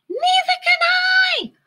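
very high, loud frightened shout; holler. A narrator's reading. Flitter lost in the dark, high frightened holler. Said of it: "hollered Flitter".

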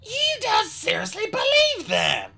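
slow, high, incredulous dragon voice; outraged cry. A narrator's reading. Skywing protests to the queen, crying out in disbelief. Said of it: "the other Skywing cried".

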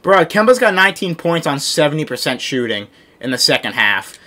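ordinary talk, nothing marked

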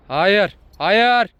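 sharp, rising warning to the dog; firm no. Real, not acted. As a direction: stop the dog firmly. Scolding dog to stop.

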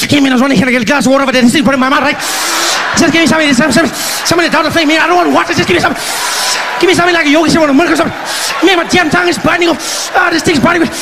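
high, loud gasping panic; comic begging for milk after the hot curry; mouth on fire. Retold or imitated. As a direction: shout for water with a burning tongue.

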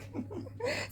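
high, quiet giggle; small real chuckle; soft laugh. Real, not acted. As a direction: giggle softly to yourself. Laughs about the prank.

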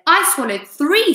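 high, exclaimed one-upping; animated centipede outburst; boastful cry. A narrator's reading. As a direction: cry it out to top the others. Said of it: "centipede cried".

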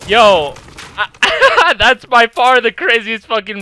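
loud hyped laughing; buzzing after a big play; excited. Real, not acted.

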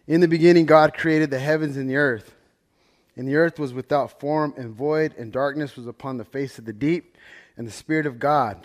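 loud, measured Bible reading; solemn reading of Genesis; scripture reading. Acted. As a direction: proclaim the verses to the congregation. Reads Genesis aloud as scripture.

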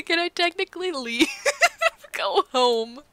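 giggling half-serious question; laughing. Real, not acted. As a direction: ask it through giggles.

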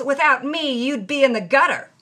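put-on a boyfriend's loud bragging quoted mid-argument; retold loud claim. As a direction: throw his bossy boast back loudly. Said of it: "He loudly claims".

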